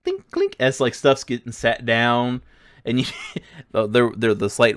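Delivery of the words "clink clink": high mimicked clinks; clinking sounds. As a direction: tap out little metal clinks. Imitating tools set down.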